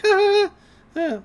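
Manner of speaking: mock laughter. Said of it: "they're like".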